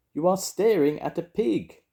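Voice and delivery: high, loud insistent correction; shouted. A narrator's reading. Charlie insisting to deaf Grandma, high shouting. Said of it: "shouted Charlie".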